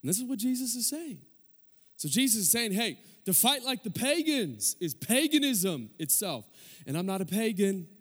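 high-pitched, pleading exhortation; earnest preaching. Real, not acted. Preacher telling the church what Jesus is saying, earnest preaching. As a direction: plead the point without shouting.

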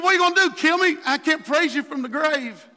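high, loud protest; worked-up, indignant challenge; David arguing with God. Acted. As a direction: plead your case loudly to God. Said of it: "He said".

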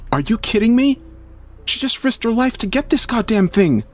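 very high, outraged squeak. A narrator's reading. Melissa protests the risk to her partner, squeaking. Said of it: "Melissa squeaked".

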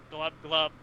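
mock gurgling creature voice; gurgling fish man croak. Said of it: "I got one better".

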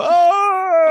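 high, loud drawn-out "oh"; mock the friend's high wail; imitated wail. Friend imitating comic whenever something's dropped, high loud wail. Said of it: "every time something's dropped, you go".

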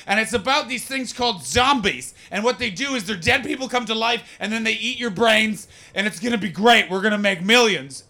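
loud, high, biting delivery; George A. Romero's biting voice. Acted. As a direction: talk as if trying to bite the listener. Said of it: "like he's trying to bite you".

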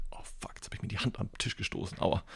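whispered curse after bumping a hand; sudden whispered ouch; hushed whisper. Real, not acted. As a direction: hiss it under your breath, stung. Hits hand on table.

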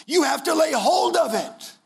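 slow, high, stretched sermon cry; preaching at full force. Acted. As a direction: lean on hold and drag it out. Preaching emphasis.

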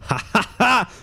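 joyful laughter. Acted. Pete laughs with joy.

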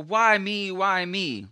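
high, drawn-out protest; imitated complaint. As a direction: plead it like you've been wronged. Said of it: "starts comparing herself to other people".

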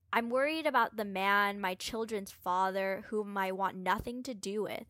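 ordinary talk, nothing marked

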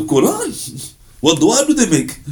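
high astonished query; stunned question. Retold or imitated. Astonished listener asks the preacher in a high stunned voice. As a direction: ask in amazement.

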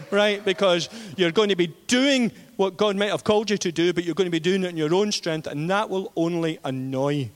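ordinary delivery

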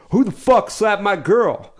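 high, slow imitation of a furious demand; mock angry confrontation. Buddy confronts the man who slapped.

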